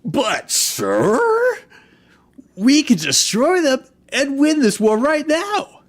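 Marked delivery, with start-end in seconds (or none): 2.6-5.8 high, loud underling pleading; pleading minion. Acted. Minion urging his lord to strike, high and loud. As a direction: push the idea hard on your master.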